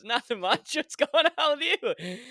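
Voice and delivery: high, loud mimicked roommate; feigned casual reply. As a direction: play innocent in an exaggerated high voice. Acting out Jack's reply to mom.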